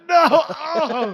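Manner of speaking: high, loud mock-crying wail; agonized wail. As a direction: cry out in hammed-up agony. Retells agony in the ring.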